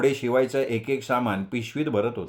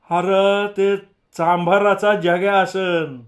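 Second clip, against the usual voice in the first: put-on slow, higher and louder, a lanky village kid drawling; older village boy answering; village boy's voice; 7 semitones higher, 6 dB louder, much slower than usual. A narrator's reading. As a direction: voice the big boy, stretched out. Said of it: "एक मोठं पोरगं म्हणालं".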